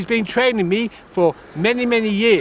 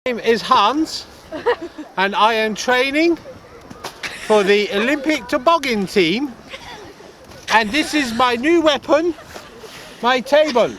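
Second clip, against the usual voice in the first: lightly put-on Norwegian accent; a hint of a Norwegian accent; mock Norwegian accent; 3 semitones higher than usual. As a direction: play a bumbling Norwegian skier. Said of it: "like a Norwegian Frank Spencer".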